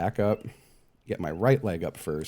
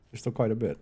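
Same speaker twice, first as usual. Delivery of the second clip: ordinary talk, nothing marked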